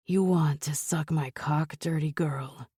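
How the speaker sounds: usual speaking voice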